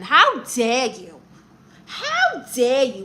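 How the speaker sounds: drawn-out, affronted outburst; deeply offended, dragging each word; indignant. Pretend. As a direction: stretch it out in mock outrage. Offended at being doubted as mother.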